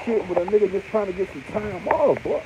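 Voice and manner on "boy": ordinary delivery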